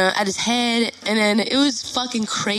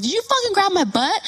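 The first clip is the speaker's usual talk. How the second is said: high, indignant accusing question; retold angry confrontation; 10 semitones higher than usual. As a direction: confront them in disbelief, voice pitched up.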